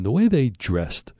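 ordinary delivery

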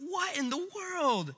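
high, breathless disbelief; stunned wonder. Acted. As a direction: drop your jaw on it. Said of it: "and they're".

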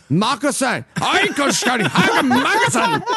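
half-hearted German accent attempt. Shown as a demonstration. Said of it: "how to do a German accent with the word magazine".

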